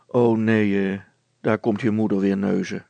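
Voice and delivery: low, loud grumble; grumbling. A narrator's reading. Brilstra hears his wife coming, grumbling. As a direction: grumble in annoyance, drawn low. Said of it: "bromde Brilstra".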